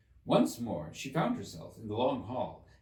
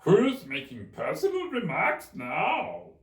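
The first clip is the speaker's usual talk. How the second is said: high, loud, slow gloating question; triumphant Hatter; 10 semitones higher, 8 dB louder, slower than usual. Acted. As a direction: crow over her, slow and loud. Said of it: "the Hatter asked triumphantly".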